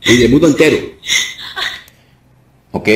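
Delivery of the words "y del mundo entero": higher, forceful declarations; deliverance preaching. Real, not acted. Pastor freeing a woman from demons, commanding preaching. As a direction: issue firm commands to cast out evil.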